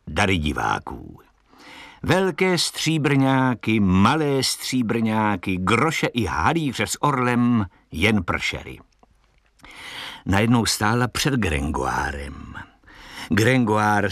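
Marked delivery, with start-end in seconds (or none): none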